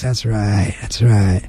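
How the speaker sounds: low, soft near-whisper; hushed mic talk. Pretend. Co-host playing along about poor mic quality, hushed and low. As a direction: drop to a low murmur into the mic. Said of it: "They talk to the mic like this".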